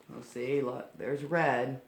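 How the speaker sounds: ordinary delivery, nothing marked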